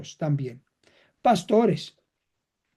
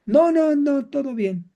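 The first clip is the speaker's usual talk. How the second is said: denial; 8 semitones higher than usual. Shown as a demonstration. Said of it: "Volvemos a la negación".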